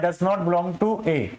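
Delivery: does not belong to A, spoken slowly word by word; slow reading aloud. Shown as a demonstration. Teacher reading the crossed-out symbol aloud, slowly. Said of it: "ये लगा हुआ है".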